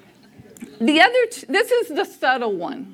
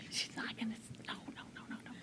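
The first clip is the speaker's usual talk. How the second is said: hushed pleading whisper, no voice; urgent whisper; 2 semitones lower, 28 dB quieter, much faster than usual. Retold or imitated. Whispering at the waiters rolling out the saddle, urgent hush. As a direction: whisper it so mom can't hear.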